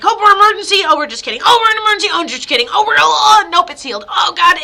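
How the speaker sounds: loud, high mock alarm flipping to relief; mock panic. Medium mocking a family's back-and-forth scare. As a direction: ham up the panic and the all-clear.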